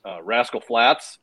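slow, modest reveal; understated. Retold or imitated. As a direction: drop the name slowly, casually. Retold Jay's understated reveal.

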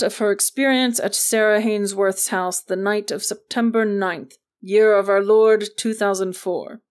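loud, official introduction for the record; formal recorded announcement. A narrator's reading. Agent dictates a formal recorder introduction.

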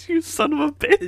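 loud, high laughing yell; mock outburst. Real, not acted. Co-host yelling at his partner in mock outrage. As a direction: blurt it out laughing.

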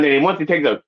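ordinary delivery

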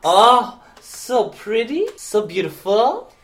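high, drawn-out cooing; mock admiring crowd. Voicing admiration of the blonde locks.

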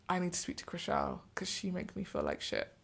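imitated German accent, softly done; Mary Lou. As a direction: have a quiet go at a German accent. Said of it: "In her German accent".

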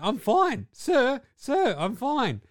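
high, loud insistent protest; pleading player brushing off the trainer, high and loud; mock injured player. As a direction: squeak out that you're fine.

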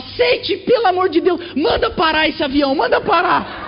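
mother begs her son to stop the plane, high desperate shouting; begging mother. Retold or imitated. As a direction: plead to stop the plane, shrieking.

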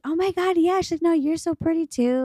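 put-on high, gushing girly voice; gushing girlfriend. Imitating the girlfriend returning the compliment in a high gush.

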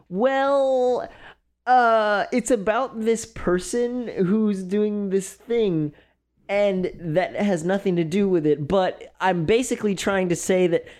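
high, drawn-out imitation of endless hedging; mock dramatic stalling. Dramatic friend dragging out hard information, high hammed-up stalling. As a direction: stretch every phrase, putting off the news. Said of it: "incredibly dramatic".

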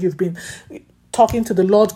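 ordinary talk

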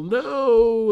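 sudden high, loud yell. A narrator's reading. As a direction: scream it out in panic. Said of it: "All of the boys began to yell".

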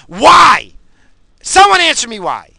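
loud, high shouted challenge; angry demand. Real, not acted. Ranting host demanding someone answer why, shouting.

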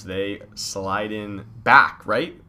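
ordinary delivery

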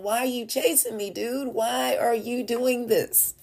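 imitated bewildered appeal; exasperated plea. Voices David's plea in modern words.

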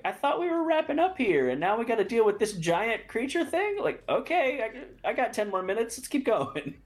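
high, exasperated disbelief; whiny, put-upon groan at the screen. Retold or imitated. Retells own exasperated reaction.

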